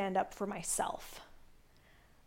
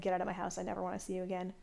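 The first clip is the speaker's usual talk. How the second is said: calmly; 3 semitones lower, 10 dB quieter, much faster than usual. Retold or imitated. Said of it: "I literally just said it so calmly".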